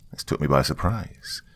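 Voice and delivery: ordinary delivery, nothing marked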